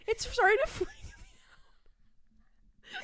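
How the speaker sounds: high, slow, whispered through laughter; breathless laughing whisper. Real, not acted. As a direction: giggle it out under your breath. Laughing at her own loudness.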